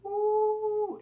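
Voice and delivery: high, loud delighted coo; a viewer hooked by the plot turning; thrilled. Real, not acted. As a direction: savour the juicy twist. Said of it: "I was like".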